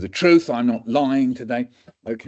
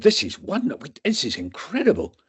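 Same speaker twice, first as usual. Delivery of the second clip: much higher, delighted doctor's voice; excited consultant; 9 semitones higher than usual. Retold or imitated. Doctor marvels to students, high and thrilled. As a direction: gush over a rare case, pitched well up.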